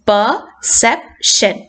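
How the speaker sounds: "perception" slowly broken up, per-CEP-tion; slow teacher's demo of "perception", stressed in the middle; stress on second syllable. Shown as a demonstration. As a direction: separate each syllable of "perception", leaning on "cep". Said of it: "That is where the stress falls".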